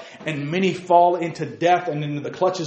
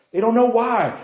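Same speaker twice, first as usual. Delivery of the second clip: high, louder cry of they don't know why; emphatic lament; 10 semitones higher, 4 dB louder than usual. Acted. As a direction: lift the repeat up in exasperation. Repeated lament.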